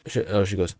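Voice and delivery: ordinary delivery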